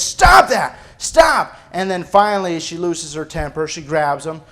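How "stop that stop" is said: put-on higher, louder yell at the kids; mom yelling. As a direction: snap it out like a fed-up mom. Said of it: "the mom's yelling at the kids".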